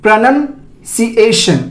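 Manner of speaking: slow "pruh-nun, si-ay-shun" for "प्रोनाउंसिएशन"; careful syllable-by-syllable pruh-nun-si-ation; correct pronunciation. Shown as a demonstration. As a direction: split "प्रोनाउंसिएशन" in two, short "pruh", rising then falling. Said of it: "इसका जो सही उच्चारण है".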